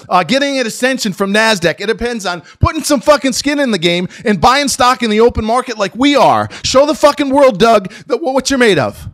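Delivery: loud, high, heated tirade; shouting at the CEO over the stock; furious rant. Real, not acted. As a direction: rail at the CEO at full volume. Ranting at the CEO.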